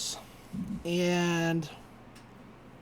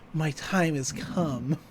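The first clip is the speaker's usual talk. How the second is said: slow, softer mock-dramatic pronouncement; Mabel's mock-solemn declaration; 3 semitones lower, 6 dB quieter, much slower than usual. Mabel volunteering as the distraction, mock-solemn and slow. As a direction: say it slowly, with imitated grave purpose. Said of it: "She's like".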